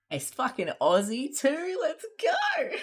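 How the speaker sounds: building to a very high, loud cheering shout; excited yell. Real, not acted. Streamer cheering over her new wine glasses, excited shout. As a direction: cheer it out, thrilled.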